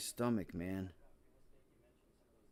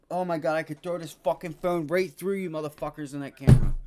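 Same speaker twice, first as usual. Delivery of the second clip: furious rant; 5 semitones higher, 9 dB louder than usual. Real, not acted. Raging at the trading app.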